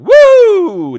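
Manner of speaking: very high, loud woo; Ric Flair woo. Retold or imitated. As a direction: let out a wrestler's woo. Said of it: "Jerry Crow is going".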